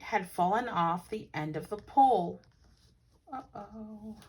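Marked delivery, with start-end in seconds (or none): 3.3-4.2 soft worried uh-oh. Real, not acted. Reader reacting to the falling bucket, soft uh-oh. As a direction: breathe a little uh-oh.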